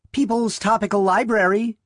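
high, bright-pitched switchboard greeting; switchboard operator. A narrator's reading. Switchboard operator answers the phone.

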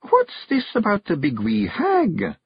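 meek on the surface, danger beneath; small and meek. Acted. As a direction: Jeanie quizzing husband, mock meek. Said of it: "as small and meek as a mouse".

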